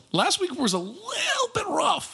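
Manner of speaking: strained, very high understatement; high-pitched squeal. Real, not acted. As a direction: voice climbing far up high. High squeal admitting last week.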